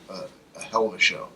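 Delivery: ordinary delivery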